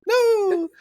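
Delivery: very high, squeaky cartoon "no, no"; squeaking scared mice. Cartoon mice hiding from the storm cloud, squeaky and panicked. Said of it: "like this".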